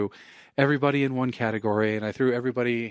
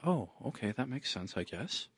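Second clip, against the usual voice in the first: deflated; 10 dB quieter than usual. Retold or imitated. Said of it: "And he goes".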